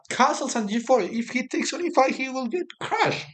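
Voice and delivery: rising, tense commentary; excited. Real, not acted. Tense live analysis.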